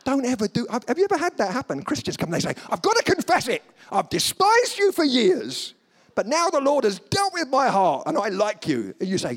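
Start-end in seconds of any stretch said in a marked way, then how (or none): 2.7-9.0 high, loud mock confession; melodramatic, high-pitched outpouring; overwrought confession. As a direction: confess with hammed-up fervour.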